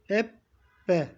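Ebbe with the b held double, eb-be; Ebbe, b said twice; doubled b. Shown as a demonstration. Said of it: "Bakın B'yi iki defa okudum".

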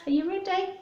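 plain reading voice

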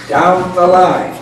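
ordinary delivery, nothing marked

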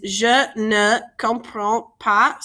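"Je ne comprends pas" slowly, word by word; "Je ne comprends pas" drawn out, rising at the end; slow breakdown. Shown as a demonstration. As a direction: separate each word of "Je ne comprends pas" for learners. Teacher breaks the phrase down slowly.

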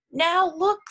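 high, loud storybook exclamation; lively pointing-out; excited announcement. A narrator's reading. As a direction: call everyone's attention, high and loud. Cat announces the cake's arrival.

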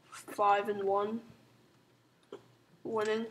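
normal talking voice

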